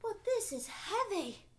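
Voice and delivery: put-on a delighted old woman puzzling over a heavy pot; excited old woman. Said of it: "And she was so excited".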